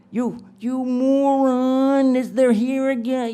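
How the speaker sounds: high, loud wail with MORON stretched out; hammed-up shrieking exasperation at him; mock angry scolding. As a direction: berate him in a drawn-out, high-pitched yell. Acted wife berating her man.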